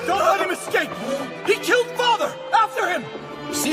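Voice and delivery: calling out. Acted. Soldiers chasing father's killer.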